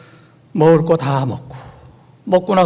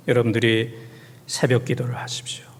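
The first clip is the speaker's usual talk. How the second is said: hushed whisper; 6 semitones lower, 7 dB quieter than usual. Acted. Urging dawn prayer.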